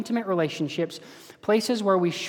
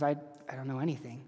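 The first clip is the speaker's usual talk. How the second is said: sheepish thief; 3 semitones lower, 9 dB quieter, faster than usual. Retold or imitated. Thief answers supervisor angel.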